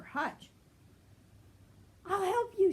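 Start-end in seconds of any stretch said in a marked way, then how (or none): 2.1-2.7 high, louder rabbit voice; eager little rabbit. A narrator's reading. Betsy the rabbit offers help, high and eager. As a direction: chirp it brightly. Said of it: "she said".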